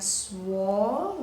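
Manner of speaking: swallowing drawn out as swal, lo, wing; split into syllables. Shown as a demonstration. Teacher counting three syllables, split into syllables. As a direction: stretch swallowing into three slow beats. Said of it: "three syllables again".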